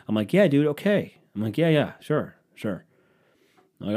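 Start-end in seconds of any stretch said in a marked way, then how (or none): 0.3-2.9 higher, louder sarcastic yeah, yeah; dismissive brush-off. Retold or imitated. Narrator brushing off the fake fan, sarcastic. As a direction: humor him to get rid of him.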